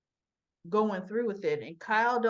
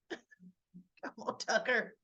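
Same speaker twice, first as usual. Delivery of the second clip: laughing disbelief; much slower than usual. Real, not acted. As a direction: chuckle as you brush off the excuse. Recapper laughs at character's excuses.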